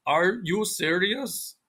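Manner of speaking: slow, word-by-word foreign accent; careful non-native foreign accent, every sound pronounced; foreigner's English. Shown as a demonstration. Imitating a foreign learner asking a question, stiff foreign accent. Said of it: "think of a foreigner going".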